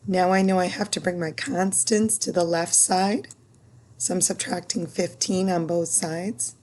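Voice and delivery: ordinary talk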